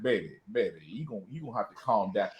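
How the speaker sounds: low, soft, soothing drone; imitating calm boyfriend. Boyfriend calming partner in a low soothing drone. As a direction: settle her down, deep and hushed. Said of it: "I can't see G having too many arguments".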